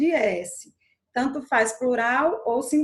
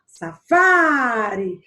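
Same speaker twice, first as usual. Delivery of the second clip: "safari" loud, with a very long "fa"; stress on "fa"; 7 dB louder, much slower than usual. Shown as a demonstration. Teacher answering on the penultimate syllable, stretched "fa". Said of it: "No fá".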